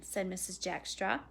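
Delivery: ordinary delivery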